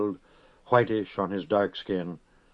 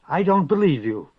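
voice shaking; 7 semitones higher, 5 dB louder than usual. A narrator's reading. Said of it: "and her voice shook".

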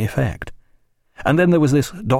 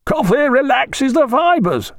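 high, slow, drawn-out pronouncement; authoritative call; 4 dB louder, slower than usual. A narrator's reading. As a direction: call it out as an authoritative ship's surgeon. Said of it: "called out the Thunderer's surgeon in an authoritative voice".

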